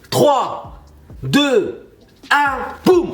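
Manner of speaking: building countdown to a loud boom; excited countdown. Real, not acted. Hookah vlogger counting down to the reveal, rising excitedly. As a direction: count down and burst out on boum.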